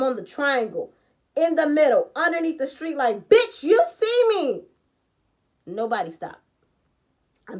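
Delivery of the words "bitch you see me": high, loud, drawn-out shout; indignant yell. Retold or imitated. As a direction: yell at a driver who ignores you. Acts out yelling at passing cars.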